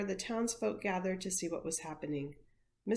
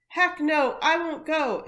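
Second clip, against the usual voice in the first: loud, high defiant shout; girl shouting at the mayor in defiance; crying out; 8 semitones higher, 10 dB louder than usual. A narrator's reading. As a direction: cry it back at the mayor. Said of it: "Imogene cried".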